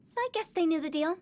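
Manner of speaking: ordinary delivery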